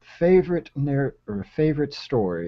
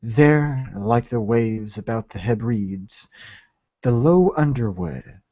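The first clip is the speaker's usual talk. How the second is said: measured, emphatic Gothic tale reading; story narration; 4 dB louder than usual. Acted. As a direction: read it out as a solemn fable, pausing between phrases. Reads Poe story aloud.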